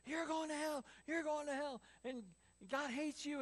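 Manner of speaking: high-pitched yell without the volume; mock screaming. Mad Max condemning passing students, mock high scream. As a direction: imitate a campus ranter, high and condemning. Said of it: "yell at people and scream at them".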